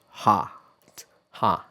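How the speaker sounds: hot with wide open ah; American hot. Shown as a demonstration. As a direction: drop the jaw for hot. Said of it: "when I pronounce it in the American way".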